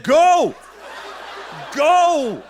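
loud, high drawn-out order; booming command. Retold or imitated. Preacher retelling God's command to go, loud and emphatic. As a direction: send them out with a shouted command. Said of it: "He said".

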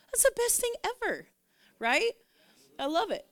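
high, delighted exclamation; gushing enthusiasm. Real, not acted. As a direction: let delight lift the voice right up. Gushing about what she loves.

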